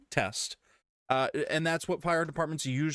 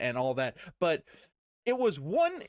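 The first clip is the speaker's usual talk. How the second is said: ordinary talk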